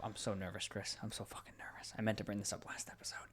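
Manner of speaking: confiding whisper, as if too nervous to say it aloud; whispered nervous admission. Real, not acted. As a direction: drop into a hushed whisper. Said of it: "Why are you whispering?".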